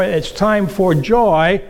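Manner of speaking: slow and loud, leaning on joy; proclaiming joy. Acted. Preacher proclaims Easter joy, slow and emphatic. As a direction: stretch out joy.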